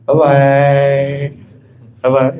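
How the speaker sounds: drawn-out mock farewell; dismissive goodbye. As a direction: wave them off with a stretched-out bye-bye. Brushes off a request to confide. (dadah panjang menolak curhat)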